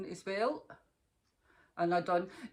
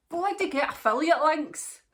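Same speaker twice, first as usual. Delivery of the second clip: much higher and louder, punching bloody; half-joking rallying cry; emphatic urging; 8 semitones higher, 6 dB louder than usual. Real, not acted. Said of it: "urging other vloggers".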